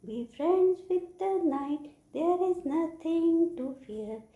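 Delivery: high, slow chanting recital; poem recitation. Shown as a demonstration. Teacher reciting the poem for children, high drawn-out chant. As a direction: chant it out high, holding the words. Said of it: "I am going to recite the poem".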